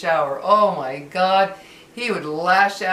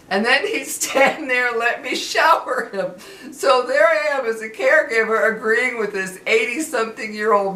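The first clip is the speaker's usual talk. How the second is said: higher and laughing while telling it; can't stop chuckling at the absurdity; real giggling narration; 5 semitones higher, 4 dB louder than usual. Real, not acted. Laughing at the memory.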